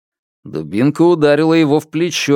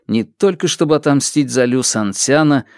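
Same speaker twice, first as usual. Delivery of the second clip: plain reading voice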